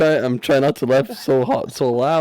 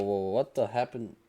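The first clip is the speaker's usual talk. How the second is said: ordinary talk, nothing marked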